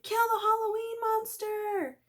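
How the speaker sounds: put-on high kids' battle cry; shouted. A narrator's reading. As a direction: cheer it on. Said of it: "we all shouted".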